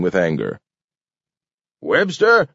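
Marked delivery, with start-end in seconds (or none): 1.8-2.5 high-pitched sharp call; angry shout. A narrator's reading. King summoning Webster across council room, shouting. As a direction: call him out angrily. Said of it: "he shouted".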